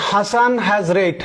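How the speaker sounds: slow read-aloud. Shown as a demonstration. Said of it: "reads example sentence slowly".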